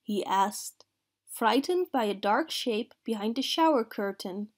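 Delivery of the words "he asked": usual speaking voice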